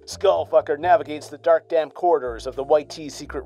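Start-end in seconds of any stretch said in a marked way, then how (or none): none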